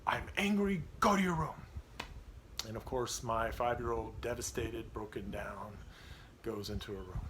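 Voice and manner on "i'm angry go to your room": put-on higher and louder, blowing up at his child; father losing his temper with his daughter, angry; angry dad. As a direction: snap as the authority parent, pitched up. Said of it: "I just blew up".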